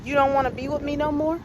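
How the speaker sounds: retold husband's rising threat; menacing question. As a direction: fire the question at her with menace. Husband repeats, looks ready to kill.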